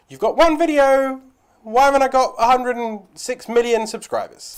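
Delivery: indignant complaint. Acted. YouTuber mimicking a creator with one video, mock indignant. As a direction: act out an impatient creator's protest. Acted complaint of an impatient newcomer.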